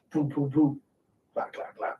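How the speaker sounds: soft booms, then near-silent rattle; imitated distant gunfire. Soldier retelling shots during a card game, softly imitated gunfire. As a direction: mouth the far-off shots quietly. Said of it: "like, the sound".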